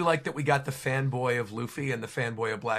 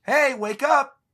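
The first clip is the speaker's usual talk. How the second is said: much higher, louder call; mysterious voice; 9 semitones higher, 7 dB louder than usual. Acted. An unknown voice wakes up Nami, high loud call. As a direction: rouse her from sleep. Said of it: "a mysterious voice saying".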